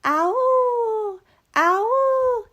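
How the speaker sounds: high dog howl; long high howling cry. Acted. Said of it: "they heard a howl".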